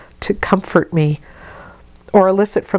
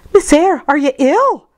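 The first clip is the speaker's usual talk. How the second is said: Bessie, concerned maid; 10 semitones higher, 3 dB louder than usual. A narrator's reading. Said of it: "said Bessie".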